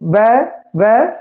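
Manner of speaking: ਵੈ slowly repeated, lips closing; slow sound demo. Shown as a demonstration. Teacher repeating ਵੈ for students. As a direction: stretch ਵੈ to show the lips. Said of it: "ਹੋਠੀ ਹੈ".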